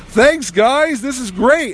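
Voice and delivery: high, cheery mock thanks; Adam West. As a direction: imitate an oblivious star calling out thanks. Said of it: "He waves. He goes".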